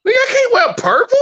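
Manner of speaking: very high disbelieving squeal; hammed-up outrage at the rule; shocked disbelief. Told he can't wear purple, high shocked mock squeal. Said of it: "It's like".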